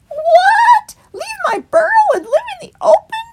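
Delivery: shocked, very high and loud; cried out. A narrator's reading. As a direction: squeal it in alarm. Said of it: "cried Stickle".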